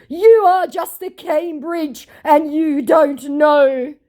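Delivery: very high, loud, slow mocking whine; mocked scammer. Scammer's garbled response to the taunts, mocking high voice. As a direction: exaggerate his garbled retort, dragged out high. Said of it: "my scammer's response was".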